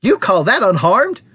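high, indignant retort; snapped. A narrator's reading. Mother challenging Wonka's claim, snapping. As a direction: snap back, drawing out the words. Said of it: "snapped Missus T V".

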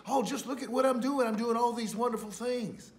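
high, loud, puffed-up mock boast; mocking boastful voice. Preacher mocking proud miracle workers, high and boastful. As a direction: mimic the show-off believers. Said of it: "And some people say".

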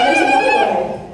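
piercing high ululation; high zaghareet trill. Shown as a demonstration. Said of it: "ways that are really great to show appreciation".

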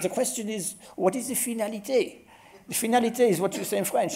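put-on high, heavy French accent on English words. Quoting Philippe de Wood's lecture in a heavy French accent. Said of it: "it's better in the French accent".

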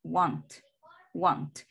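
"won't" as one short falling syllable; a single brief beat on "won't"; forma corta. Shown as a demonstration. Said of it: "La forma corta de will not es won't". ("won't" en una sílaba corta)

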